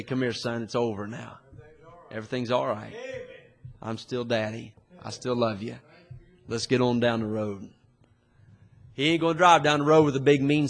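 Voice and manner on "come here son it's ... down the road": soft, gentle, quiet father's voice, lightly voiced; tender fatherly God. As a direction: reassure a son like a loving father. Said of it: "draw me with loving kindness and say".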